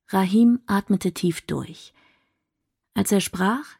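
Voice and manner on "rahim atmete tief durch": ordinary delivery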